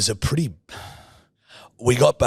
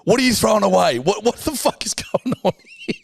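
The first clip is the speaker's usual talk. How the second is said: mock outrage; 10 semitones higher than usual. [name] protests to Steph cleaning his car, mock outrage. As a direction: yell it like your stuff is being binned. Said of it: "I'm like".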